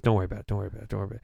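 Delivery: low, quick, soft repetition; dismissive brush-off. Retold or imitated. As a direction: trail off softly as you wave it away. Retold brush-off by veteran.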